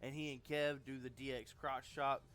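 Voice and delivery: ordinary talk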